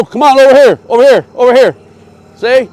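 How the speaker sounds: shouting across the lot, high and loud; calling from far away. Real, not acted. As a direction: yell her over to you from a distance. Coach yelling rider toward him.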